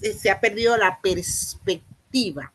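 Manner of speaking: ordinary talk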